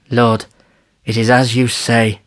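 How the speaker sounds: put-on mildly plaintive old chief; understated, doleful submission; moaned. A narrator's reading. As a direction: concede with a slight moan.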